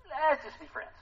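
a touch of a friend-zone letdown; mock brush-off. Peter telling Jesus let's just be friends, mock brush-off.

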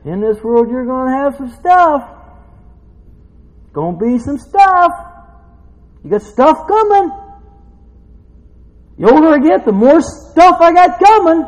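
loud, high sermon delivery; fired-up preaching. Real, not acted. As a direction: preach it with rising force. Preaching at full force.

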